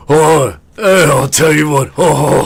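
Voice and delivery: loud, high hearty blacksmith; dragonborn blacksmith. Acted. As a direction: boom it out like a jolly smith. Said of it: "sounding like a dragonborn blacksmith".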